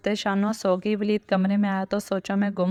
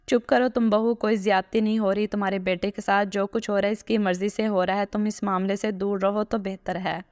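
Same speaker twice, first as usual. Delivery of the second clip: plain reading voice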